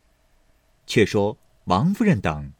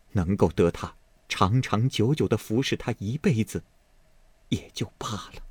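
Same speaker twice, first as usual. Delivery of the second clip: hushed, breath-heavy, tearful voice; 5 dB quieter than usual. Acted. Mother grateful for her son's devoted maid, speaking tearfully. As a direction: sigh it out softly, close to tears. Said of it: "王夫人含泪说道".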